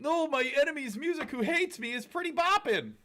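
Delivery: high, loud mocking fan voice. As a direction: sneer it like a clueless fan.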